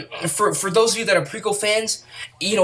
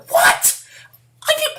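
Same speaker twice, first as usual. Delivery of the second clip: ordinary delivery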